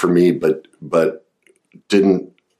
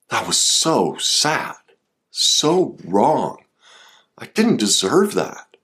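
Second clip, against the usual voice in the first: sad grief voice; 5 semitones higher, slower than usual. Shown as a demonstration. Therapist modeling grief for the listener, sad. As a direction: voice the hurt child's sorrow. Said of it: "Allowing yourself to feel the weight".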